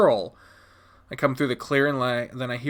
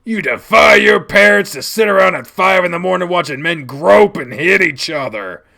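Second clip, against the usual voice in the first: loud, raised, forceful tirade; furious rebuke; 5 semitones higher, 10 dB louder than usual. Acted. Dad berating child for defying parents, furious rebuke. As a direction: lay into the child angrily.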